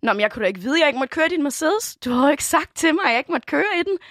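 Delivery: lightly acted excuse of a car thief; indignant mock defendant. Car thief to the owner in court, mock indignant. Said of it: "slæbt i retten, så sagde jeg".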